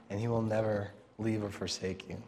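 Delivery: ordinary talk, nothing marked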